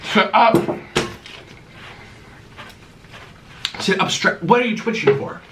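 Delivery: high scolding pet voice; talking to the dog. Real, not acted.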